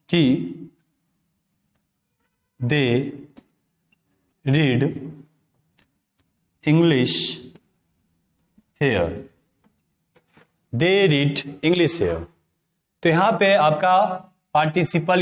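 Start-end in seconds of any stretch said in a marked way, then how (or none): 2.6-12.2 one word at a time, then the whole sentence drawn out slowly; slow dictation. Shown as a demonstration. Teacher gives students an example sentence, dictating slowly. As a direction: dictate it clearly for note-taking.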